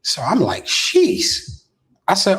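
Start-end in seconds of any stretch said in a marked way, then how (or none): 0.6-1.6 sheesh. Retold or imitated. Reacting to a money offer.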